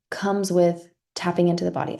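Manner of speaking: ordinary talk, nothing marked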